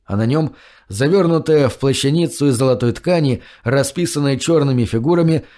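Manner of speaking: ordinary delivery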